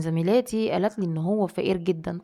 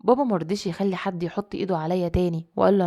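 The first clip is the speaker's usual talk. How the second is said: usual speaking voice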